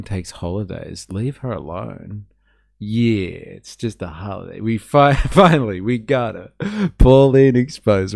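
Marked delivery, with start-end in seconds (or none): 4.6-8.2 laughing, triumphant and loud; gleeful gloating. Real, not acted. Streamer gloating to chat, excited and laughing. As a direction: crow over the catch with a laugh. Gloating over catching Pauline.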